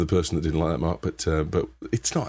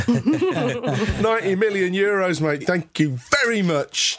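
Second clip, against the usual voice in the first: much higher, drawn-out gloating cheer; mock jubilation; 13 semitones higher than usual. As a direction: crow slowly over an imagined jackpot. Crowing over imagined lottery win.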